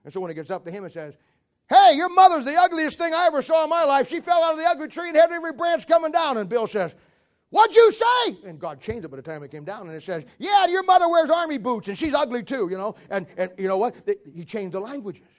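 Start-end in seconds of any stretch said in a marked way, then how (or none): 1.7-6.4 high, loud hollering down a tower; yelled insult. Acted. Worker hurling an insult at his mate, loud yell. 7.5-8.4 very high, loud yelled challenge; indignant shout. Acted. Bill yelling back at his workmate, indignant shout. 10.4-12.7 high, loud taunt easing at the end; yelled comeback. Acted. Worker trading insults up the tower, loud yell.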